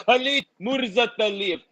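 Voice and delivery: ordinary talk, nothing marked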